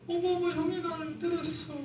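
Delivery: put-on high, childish tattling voice, half laughing; a little kid complaining to mom in a squeaky voice; whiny little boy. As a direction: play the younger son telling on his brother. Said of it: "여자였구나".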